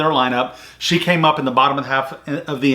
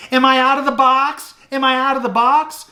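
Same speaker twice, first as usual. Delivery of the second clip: high-pitched mocking challenge; imitating indignant player; 10 semitones higher than usual. Batter turning on a fan in the stands, high mocking retort. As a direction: throw the question back, high and pointed. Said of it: "she turned around and she says".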